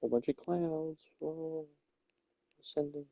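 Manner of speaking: soft and high, slow wonder; hushed awe. Real, not acted. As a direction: breathe it out softly in amazement. Floating up into the clouds.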